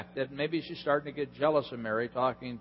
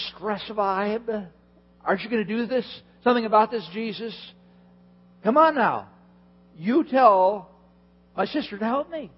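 high, loud, whiny complaint; stressed Martha; 6 semitones higher, 5 dB louder than usual. Retold or imitated. Preacher voicing Martha griping to Jesus about her sister, high and whiny. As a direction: plead with Jesus, overwhelmed and fed up.

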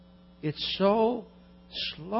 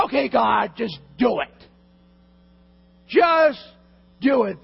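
louder and higher, grudging surrender to God; exasperated demand; 4 semitones higher, 6 dB louder, slower than usual. Acted. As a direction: shout it out in fed-up resignation, slow on the last words. Exasperated prayer giving in.

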